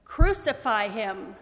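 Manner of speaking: loud, slow insistent shout. A narrator's reading. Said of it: "they shouted all the louder".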